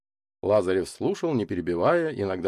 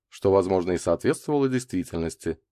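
plain reading voice

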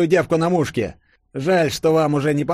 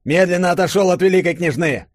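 higher, quick command; shouted order; 7 semitones higher, 3 dB louder, faster than usual. A narrator's reading. Kolchak orders Denis away from the grand duchess, shouting. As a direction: order him back at gunpoint. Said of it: "крикнул он Денису".

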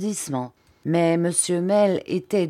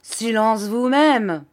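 slow, loud, higher-pitched comeback; angry retort; 5 semitones higher, 6 dB louder, slower than usual. A narrator's reading. Pupil defying his teacher, angry retort. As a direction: throw the order back indignantly. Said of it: "dit Steerforth en devenant très rouge".

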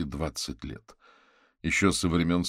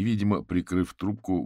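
usual speaking voice

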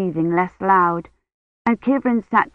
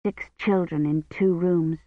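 plain reading voice

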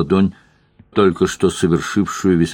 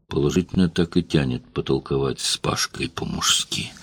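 whisper; 4 semitones lower, 6 dB quieter than usual. A narrator's reading. Inner thought, unvoiced.

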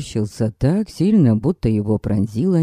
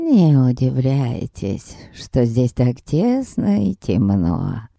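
put-on slow, lower toothless old woman mumble; mumbling old lady; 4 semitones lower, slower than usual. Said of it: "прошамкала старушка".

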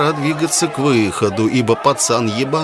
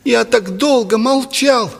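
put-on high, anguished boy's confession; agitated falsetto; 12 semitones higher than usual. A narrator's reading. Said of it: "дребезжащим от волнения фальцетом".

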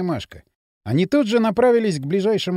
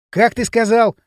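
angry challenge; 6 semitones higher, 7 dB louder than usual. A narrator's reading. Provoked Kirill lunges at Dryn.

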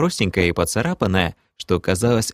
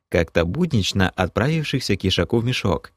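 ordinary delivery, nothing marked

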